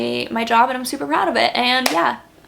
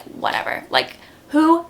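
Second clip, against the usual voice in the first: dismissive; 5 semitones higher, slower than usual. Retold or imitated. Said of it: "now I'm kinda just like".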